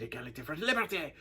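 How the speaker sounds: lightly voiced French passengers chanting the motto; a hint of French travellers, mildly imitated; grateful French passengers. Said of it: "they said".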